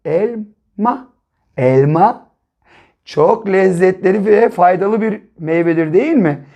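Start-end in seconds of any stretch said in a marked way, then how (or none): 0.0-2.3 Elma joined as el plus ma in one flow; blended word elma. Shown as a demonstration. Teacher blending syllables into a word for children. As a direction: run el straight into ma. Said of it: "birleştirelim".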